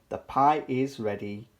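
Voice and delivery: plain reading voice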